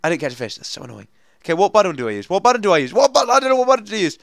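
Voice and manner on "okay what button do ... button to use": building to a high, loud fluster; mounting panic. Real, not acted. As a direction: get more frantic with every repeat. Panicking over which button to press.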